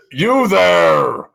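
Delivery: loud, booming god voice. Acted. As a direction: bellow it down from on high. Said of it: "I bellow down in my most kind of, like, booming voice".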